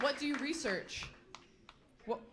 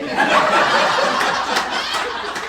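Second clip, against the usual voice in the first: big high burst of laughter; loud cackle; 11 semitones higher, 11 dB louder than usual. Real, not acted. Comedian at her own joke, loud high cackle.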